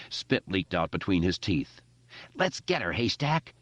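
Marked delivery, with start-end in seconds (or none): 2.3-3.5 high, wild battle cry; manic scream. Acted. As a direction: call him into the fight. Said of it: "Richie screamed".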